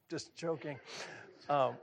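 ordinary delivery

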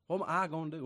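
ordinary delivery

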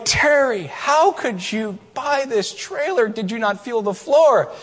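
high, slow mock-incredulous scolding; teasing friend. [name] ribbing Terry about the rotten trailer, high mock scolding. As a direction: rib him with exaggerated disbelief.

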